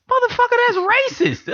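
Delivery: high-pitched exasperated outburst; retold protest, voice leaping way up; exasperated retort. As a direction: shoot it back in a squeaky, incredulous pitch.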